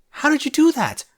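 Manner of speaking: high, loud shocked yell. A narrator's reading. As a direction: scream it in sudden fright. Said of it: "Rarity yelled".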